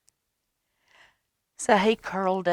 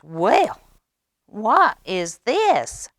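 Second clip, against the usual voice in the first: higher, louder, slow curious duckling; little duck voice; 6 semitones higher, 10 dB louder, much slower than usual. Acted. The little duck, just hatched, puzzling at the bunny. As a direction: wonder aloud as a tiny duckling. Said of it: "this is the little duck talking".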